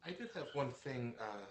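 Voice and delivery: faint, far from the mic; mic barely picks it up; really quiet. Real, not acted. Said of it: "You're really quiet".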